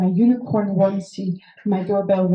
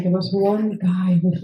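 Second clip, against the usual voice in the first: ordinary delivery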